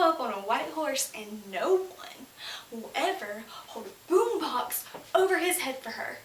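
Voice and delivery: loud, drawn-out, emphatic declaiming; impassioned build. Real, not acted. Poet railing against movie romance, impassioned. Climax of slam poem on romance.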